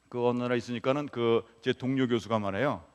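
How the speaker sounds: ordinary delivery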